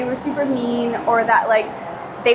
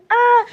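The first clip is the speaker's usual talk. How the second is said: short, loud, high squeal; happy squeal; 12 semitones higher, 6 dB louder than usual. Real, not acted. Vlogger got a free keychain, high happy squeal. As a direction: squeal briefly over a little gift.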